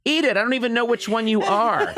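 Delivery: high exasperated dad snapping; fed-up father barking at the kid; shouting father. Retold or imitated. Father tells his son to shut up and eat. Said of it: "And he was like".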